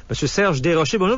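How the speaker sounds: normal talking voice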